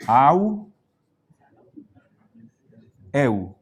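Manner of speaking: au, then eu, each gliding into oo; diphthong demo. Shown as a demonstration. Teacher shows how the diphthongs sound, deliberate demo. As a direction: say au and eu apart, with a pause between. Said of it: "el sonido va a ser".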